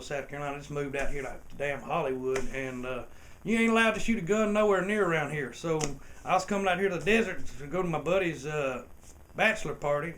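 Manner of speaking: put-on talking his way out in a thick Southern accent. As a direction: play the good ol' South Carolina boy to the cop. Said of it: "throw on my redneck accent".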